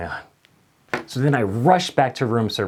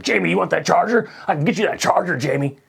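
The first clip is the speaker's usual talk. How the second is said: higher, eager sucking-up voice; fawning manager; 7 semitones higher, 3 dB louder, faster than usual. Retold or imitated. Restaurant manager sucking up to [name], fawning. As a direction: fawn over the star. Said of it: "manager guy who was like".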